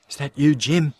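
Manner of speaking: loud, high and scared; frightened call. Acted. Wife calling out in the dark to husband, scared.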